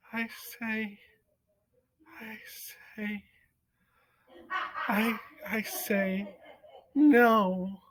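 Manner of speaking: halting, breathless whisper; young mouse whispering his defiance. A narrator's reading. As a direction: barely get the words out. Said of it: "whispered Despero".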